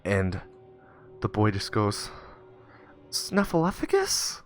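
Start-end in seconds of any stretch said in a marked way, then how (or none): 3.1-4.4 high, slow, tentative question; timid boy. Acted. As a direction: confess it like an unsure kid. Boy timidly reveals the word.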